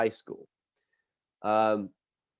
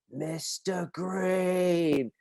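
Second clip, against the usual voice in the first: long, drawn-out, higher summons; stern teacher call; 6 semitones higher, 4 dB quieter, much slower than usual. Retold or imitated. PE teacher calling out the culprit, stern. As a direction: call the name slowly across a field.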